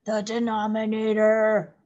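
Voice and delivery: looming movie-monster voice; scary monster voice. Pretend. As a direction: growl it like a monster. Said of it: "sounds like a scary monster in a movie".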